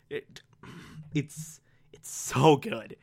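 awed breathy whisper, slow. Real, not acted. Whispering in awe to viewers about the episode. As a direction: hush it like you can't believe how good it is. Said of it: "awed whisper".

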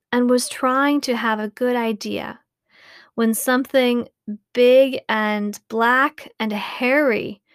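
plain reading voice

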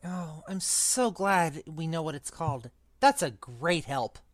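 sarcastic snarl. A narrator's reading. Trapped boy to his unhelpful friend, restrained snarl. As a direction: grumble it softly with heavy sarcasm. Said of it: "snarled Ron".

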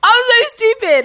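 real laughing self-mockery. Real, not acted. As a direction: squeal it out mid-laugh. Laughing at her own joke.

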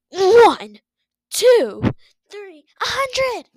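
loud, high counting; loud boastful counting. Acted. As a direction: count like showing off. Lion Blaze counting push-ups.

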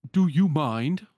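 a sleepy wardrobe objecting loudly; grumpy wardrobe voice. Acted. Wardrobe grumbles at being moved.

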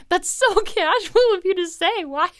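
can't stop giggling at mom's casual answer; laughing disbelief. Real, not acted. Telling listeners about mom's surprise gift, laughing in disbelief.

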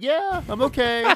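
high, stretched-out reassuring call; yelling from afar. Retold or imitated. Worker yelling from the back to worried customers, drawn-out high call. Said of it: "I'm just yelling from the back".

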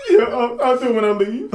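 high voice breaking into laughter; can barely get the words out for laughing; laughing quote. Retold or imitated. Retelling the phone call with his mom, laughing.

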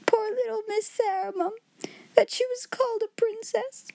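high, sobbing lament; crying afresh. A narrator's reading. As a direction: break into fresh tears over her. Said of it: "breaking out afresh".

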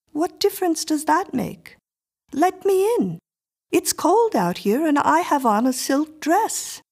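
put-on high, insistent little girl voice; a strange child demanding entry; eerie little girl. A narrator's reading. Miriam pushes her way in.

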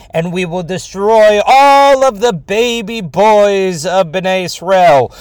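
loud, high, slow evil decree; mad scientist. Acted. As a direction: boom it out like a gloating tyrant. Pharaoh voiced in a story.

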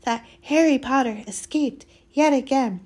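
high, loud elf voice; hoarse house-elf. A narrator's reading. Elf telling of Harry's escape, hoarse. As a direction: recount it in a high, awed elf voice. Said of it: "he said hoarsely".

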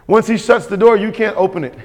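ordinary delivery

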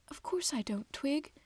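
tearful, hushed and breathy; sobbing whisper. A narrator's reading. Mother sobbing to her son, whispered. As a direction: whisper through tears. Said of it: "she sobbed".

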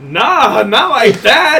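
mock panicked denial. Hasty denial to angry boxer friend.

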